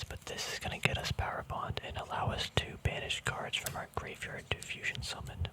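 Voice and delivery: normal talking voice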